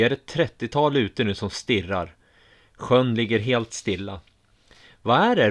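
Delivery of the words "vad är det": loud, higher frightened call. A narrator's reading. A woman calling out to the crowd, loud. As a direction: call out into the dark. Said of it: "ropar en kvinna".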